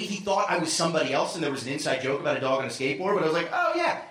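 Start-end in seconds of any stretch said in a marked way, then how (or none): 3.5-4.0 very high humoring agreement; polite fake agreement. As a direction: play along politely, pitched way up. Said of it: "I did the polite thing".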